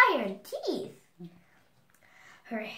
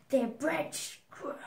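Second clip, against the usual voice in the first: ordinary delivery, nothing marked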